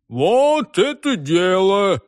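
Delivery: slow, drawn-out exclamation; hearty approval. A narrator's reading. Burly reveller welcomes the night out, drawn-out hearty approval. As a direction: relish it, stretching the words out.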